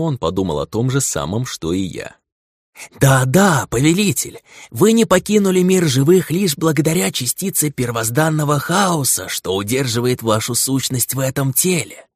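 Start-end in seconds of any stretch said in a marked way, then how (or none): 3.0-12.1 servile creature voice. Acted. Servant creature addresses master.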